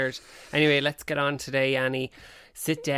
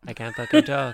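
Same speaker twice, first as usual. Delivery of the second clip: laughing; 7 dB louder, faster than usual. Real, not acted. Repeats laughing louder.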